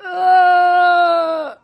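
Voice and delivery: high, loud bawling shout; crying shout. A narrator's reading. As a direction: howl it like an inconsolable little kid. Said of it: "while Bertie cried".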